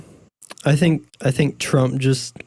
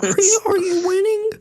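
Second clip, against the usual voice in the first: grandpa voice. Retold or imitated. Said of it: "when my grandpa comes behind me".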